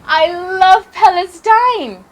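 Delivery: high, drawn-out cry of delight; thrilled child declaring love; excited exclamation. A narrator's reading. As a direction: exclaim it joyfully, stretching the words. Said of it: "I excitedly exclaimed to Baba".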